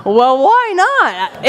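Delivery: high, drawn-out comic acceptance; gamely agreeing. Retold or imitated. Invited into a film across the street, gamely agreeing. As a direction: stretch it out as a playful yes. His game reply, retold.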